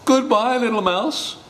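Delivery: high-pitched hasty farewell; high, anxious storybook animal; flustered fox. Acted. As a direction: play a spooked fox bidding goodbye. Fox flees.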